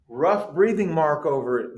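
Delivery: usual speaking voice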